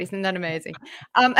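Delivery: normal talking voice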